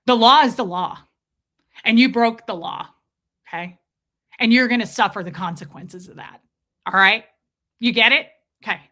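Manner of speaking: loud, hammering lecture to camera; imitating him threatening viewers through the camera; mock stern threat. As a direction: stare down the lens and lay down the law. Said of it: "look right into the camera and go".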